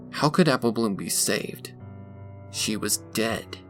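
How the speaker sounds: ordinary delivery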